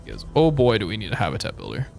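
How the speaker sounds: ordinary delivery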